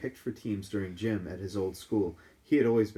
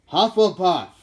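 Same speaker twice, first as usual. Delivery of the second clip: loud, high Sorting Hat shout; booming magic hat announcement; 10 semitones higher, 14 dB louder, slower than usual. Acted. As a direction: bellow the house name to the hall. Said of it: "shouted the hat".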